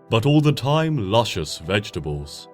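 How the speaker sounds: ordinary delivery, nothing marked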